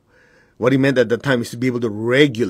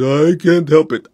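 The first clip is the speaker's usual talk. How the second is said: higher, louder, slow mock whine; whiny excuse mimicry; 4 semitones higher, 5 dB louder, slower than usual. Teacher mimicking people who give up choice, slow whine. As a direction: drag out a helpless excuse. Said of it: "they give up that choice because".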